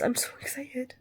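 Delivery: excited whisper. Real, not acted. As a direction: whisper it with glee. Whispered then repeated aloud.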